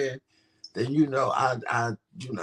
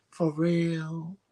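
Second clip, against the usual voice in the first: higher, questioning put-on voice; imitating flirted-with women; 4 semitones higher than usual. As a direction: lift it high as a coy customer. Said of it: "Then they get on".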